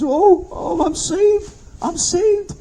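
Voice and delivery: high, tearful cry of relief, acted out; imitated joyful crying. Storyteller voicing a dying man just saved, imitated tearful crying. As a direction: wail it like a convert overcome with joy. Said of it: "and he starts crying".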